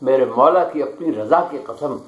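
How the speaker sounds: measured, declaiming poetry recital; stately nazm recitation. Acted. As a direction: recite each line with weight. Poet recites his nazm. (پُروقار نظم خوانی)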